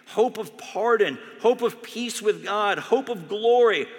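loud, slow stress on each hope; emphatic reading. Acted. Pastor reading Packer's hope to the congregation, emphatic. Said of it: "emphatic reading of Packer".

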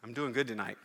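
normal talking voice

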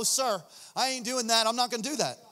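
normal talking voice

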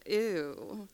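soft, grossed-out ew; lightly voiced disgust; mock disgust. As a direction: wrinkle your nose at the smell. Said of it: "a little bit of disgust".

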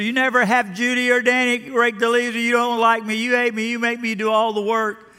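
mock kid tantrum. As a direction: whine it like a sulking ten-year-old. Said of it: "I pitched a temper tantrum".

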